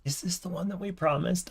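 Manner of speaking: ordinary delivery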